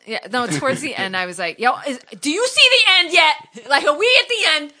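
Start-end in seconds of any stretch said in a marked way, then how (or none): 1.6-4.7 louder, higher, impatient calling out. Retold or imitated. Fed up in the corn maze, calling out impatiently. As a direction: call ahead, desperate to be done.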